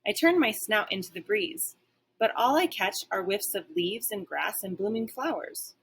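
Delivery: plain reading voice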